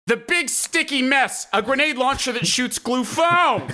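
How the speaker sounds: high, strained imitation of a jittery salesman; nervous vendor. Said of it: "a man who is extremely nervous".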